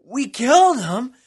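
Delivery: slow, high, loud gloating gasp; hell's hordes shrieking in triumph; gasping forces of hell. Acted. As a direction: gasp it out like demons who think they won. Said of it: "forces of hell gasped with victory".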